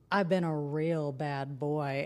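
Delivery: deep, very slow imitation of a man; mock naughty confession. Boyfriend repeats confession, retold.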